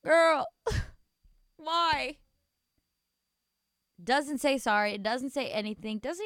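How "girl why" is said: loud, high, gasping exclamation; exasperated disbelief. Real, not acted. Host to the girl about her boyfriend, exasperated disbelief. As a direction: cry out to her in dismay.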